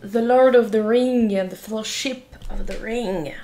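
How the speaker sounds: put-on English accent. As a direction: read the title like a proud English speaker. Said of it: "Cet accent anglais est incroyable".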